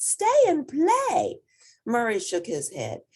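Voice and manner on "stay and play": high, slow sing-song call across the snow. A narrator's reading. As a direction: call him to come play, drawn out. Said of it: "Snowman called out".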